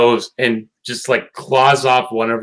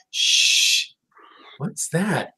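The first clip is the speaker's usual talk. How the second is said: shushing; 4 semitones higher, 6 dB quieter, much slower than usual. Retold or imitated. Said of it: "and it's like".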